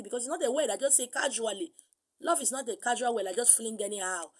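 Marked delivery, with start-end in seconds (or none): none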